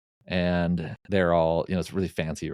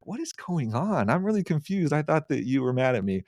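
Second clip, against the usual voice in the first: baffled, what is happening; confused; 7 semitones higher than usual. Retold or imitated. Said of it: "I was like".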